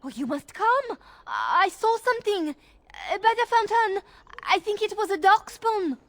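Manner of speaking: high, breathless mock alarm; faked panic. Erlina fakes panic to lure the guards, high mock alarm. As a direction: play the frightened maid to lure them away. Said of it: "I will go distract the guards".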